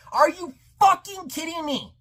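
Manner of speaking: high-pitched incredulity, no real shout; retold yelling. Viewer losing his cool at the third act, retold yelling. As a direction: re-enact outrage at an ordinary volume. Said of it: "I started yelling".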